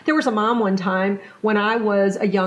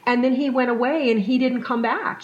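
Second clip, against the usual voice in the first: ordinary delivery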